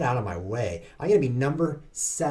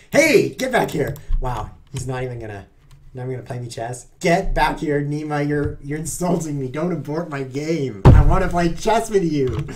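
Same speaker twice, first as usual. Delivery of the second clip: loud indignant calling; 3 semitones higher, 8 dB louder than usual. Real, not acted. Streamer yells at the opponent who is leaving, loud and indignant. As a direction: demand he come back and play. Opponent Nima aborts the game.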